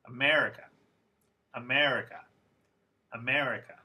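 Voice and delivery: America with a long second syllable, a-MER-i-ca; lengthened stressed vowel. Shown as a demonstration. Teacher modelling vowel length for students, stressed MER in America. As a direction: lean on MER in America, other syllables short. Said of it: "These sounds should sound a little longer".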